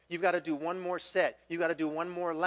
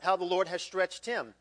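ordinary delivery

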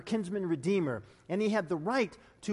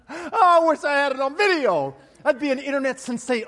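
excited laughing; 6 semitones higher, 8 dB louder than usual. Real, not acted. Delighted laugh after story.

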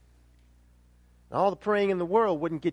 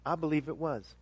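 ordinary delivery

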